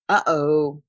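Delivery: mild dismayed uh-oh. Acted. Kayla reacting when the conversation breaks, mild dismay. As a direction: notice the slip. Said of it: "said Kayla".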